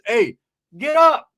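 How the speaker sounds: high, loud, stretched-out call; wake-up shout. Retold or imitated. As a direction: shout him out of bed. Waking Roach in the story.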